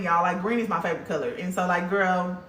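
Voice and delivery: ordinary talk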